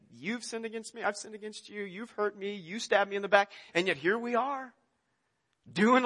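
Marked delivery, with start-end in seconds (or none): 0.1-3.5 much softer, resentful inner mutter; muttered grievances. Retold or imitated. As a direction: grumble it under your breath at the table. Resentful thoughts at family table.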